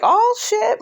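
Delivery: high-pitched "oh shit" of mock alarm. Viewer reacting to Wanda's threat, mock alarm. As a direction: jump up high in playful shock.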